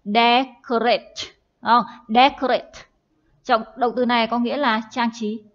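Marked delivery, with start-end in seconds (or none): 0.0-1.1 Decorate, DEC punched loud and drawn out slowly; stress on first syllable. Shown as a demonstration. Teacher shows the class where the stress falls, DEC stressed. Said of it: "trọng âm ở âm tiết đầu tiên". 2.1-2.7 Decorate, loud first syllable DEC; stress on first syllable. Shown as a demonstration. Teacher repeating for the class, stress on DEC. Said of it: "trọng âm ở âm tiết đầu tiên".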